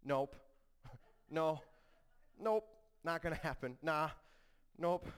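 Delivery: curt comic refusals with pauses between; mock refusal. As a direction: play Peter flatly refusing, for laughs. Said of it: "what is Peter's response when the Lord speaks".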